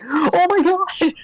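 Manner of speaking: high, slow panicked yelp; terrified nerd with a knife at his throat. Acted. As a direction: squeal it in terror. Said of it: "He's like".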